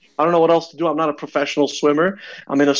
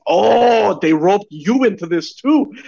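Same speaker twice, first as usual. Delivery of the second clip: retold dawning realization; 5 semitones higher, slower than usual. Swimmer spotting an equally lost rival, sudden realization. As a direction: exclaim it as the penny drops.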